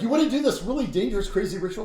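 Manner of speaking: high, loud hyped-up pitch; mock excited offer. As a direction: imitate people daring you, amused and loud. Voices people offering a wild ritual.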